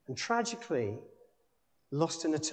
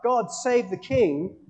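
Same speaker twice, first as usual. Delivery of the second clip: crowd shout; 8 semitones higher, 8 dB louder, slower than usual. Retold or imitated. Crowd acclaims the king.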